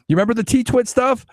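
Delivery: ordinary delivery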